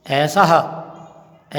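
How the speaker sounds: "ऐसा ह" ending in an audible ha; visarga as "ha". Shown as a demonstration. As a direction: slowly show the final "ह" as a separate ha. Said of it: "ऐसा ह बोलेंगे ऐसे".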